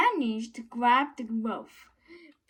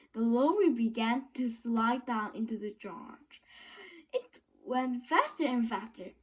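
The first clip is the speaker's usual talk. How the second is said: plain reading voice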